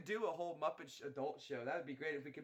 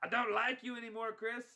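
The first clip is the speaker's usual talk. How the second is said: pitched up, sulky jab at Chris; high put-on pouty voice; 8 semitones higher, 4 dB louder than usual. Teasing a friend, a high sulky put-on voice. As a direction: pout it out in a higher voice.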